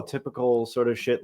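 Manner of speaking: usual speaking voice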